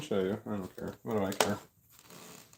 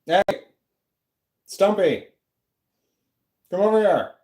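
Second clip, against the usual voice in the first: calling the dog; 6 semitones higher than usual. Real, not acted. Streamer calling the barking dog over, coaxing call.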